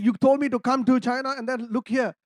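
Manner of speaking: loud exasperated prayer; frustrated complaint. Retold or imitated. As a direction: vent the frustration upward. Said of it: "I said".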